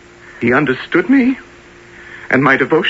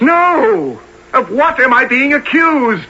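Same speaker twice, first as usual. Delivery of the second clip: high, sharp cry of protest; indignant outburst; 7 semitones higher, slower than usual. Acted. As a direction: fling the denial back at him. Defendant bursts out at prosecutor.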